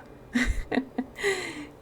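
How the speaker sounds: high amused giggle. Real, not acted. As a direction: laugh at her own slip. Laughs at own slip.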